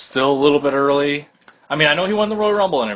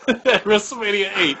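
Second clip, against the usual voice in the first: normal talking voice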